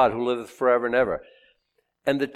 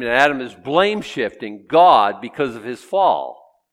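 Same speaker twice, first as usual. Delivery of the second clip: ordinary talk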